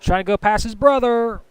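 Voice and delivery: brisk race-call commentary, rising toward the finish; live play-by-play. Acted. Commentator calling the final laps to viewers, live play-by-play.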